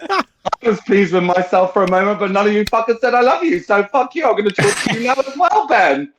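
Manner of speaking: high-pitched, worked-up complaint; hurt indignation. Real, not acted. Berating friends who withheld love, high indignant complaint. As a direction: scold them in a high, wounded voice.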